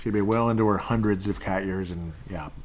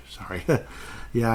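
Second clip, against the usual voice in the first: usual speaking voice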